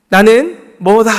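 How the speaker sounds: 천천히 힘주어 선포; emphatic declaration. A narrator's reading. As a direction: spell out each word with weight. Quoting Jesus' I-am formula.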